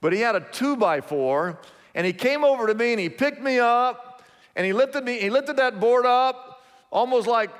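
usual speaking voice

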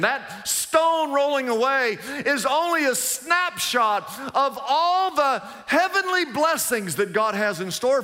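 rising preacher's cry; preaching build. Real, not acted. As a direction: drive it home to the crowd.

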